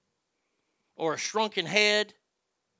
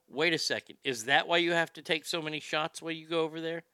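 ordinary talk, nothing marked

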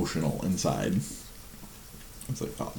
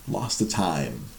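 ordinary delivery

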